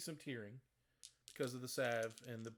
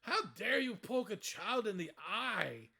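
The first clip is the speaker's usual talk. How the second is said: indignant; 7 semitones higher, 5 dB louder, slower than usual. Acted.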